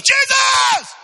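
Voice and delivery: high, full-force cry over the crowd. Retold or imitated. Preacher acting blind man defying the crowd, huge shout. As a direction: scream out for your Savior, hammed-up. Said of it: "Bible says he shouted all the more".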